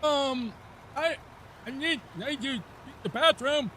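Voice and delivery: put-on high, childlike whiny voice; special voice. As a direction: squeak it out high like a helpless kid. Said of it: "I just use my special voice".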